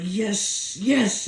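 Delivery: very high, loud yes yes; dad celebrating success, very high and loud; triumphant yes. Retold or imitated. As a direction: celebrate the win. Success moment.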